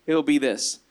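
ordinary talk, nothing marked

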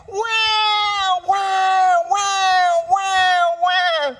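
loud, very high mock baby wail; imitated baby crying. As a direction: wail "wah" over and over like a baby. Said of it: "when that baby is crying".

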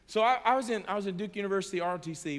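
ordinary talk, nothing marked

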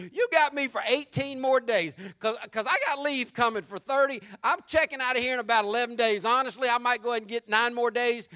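loud, breezy soldier who doesn't care; carefree short-timer. Retold or imitated. Soldier near discharge, loud and carefree. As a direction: shrug it all off like a man about to leave. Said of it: "It's like".

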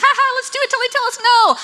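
high, fast, giddy mock daredevil; hammed-up party-animal squeal; carefree rule-breaker. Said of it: "someone who likes to play, like, fast and loose".

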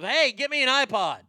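loud, high pointed hint; hammed-up demand; mock hint. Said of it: "let out the suggestion of".

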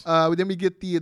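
ordinary talk

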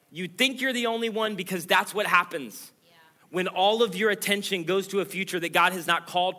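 high, loud, building repetition; preaching at full force. Acted. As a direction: hammer home each that's what happens.

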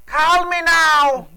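high, loud, drawn-out call; playful shout. Pretend. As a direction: belt it out like a hammy hotline plug.

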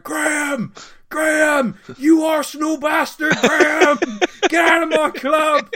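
loud, high-pitched terrace heckling; imitating shouting fan. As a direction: bellow abuse at the manager like an irate supporter. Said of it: "all he did for the whole time I was there was shout".

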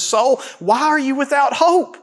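high, rising plea to his own soul; a psalmist pleading with his soul; preaching to himself. Acted. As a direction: urge your soul, rising. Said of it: "he preached to himself".

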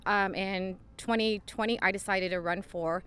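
ordinary talk

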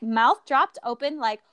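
ordinary talk, nothing marked

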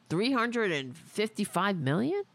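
ordinary talk

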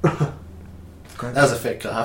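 talking through giggles; laughing. Real, not acted. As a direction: laugh while you speak. Both laughing at the cough.

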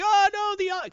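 loud, very high frightened cry; mock panic. Witnesses panicking at sight of Spider-Man, mock high cry. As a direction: yelp it in hammed-up terror.

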